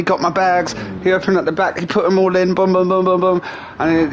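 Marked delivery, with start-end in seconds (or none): none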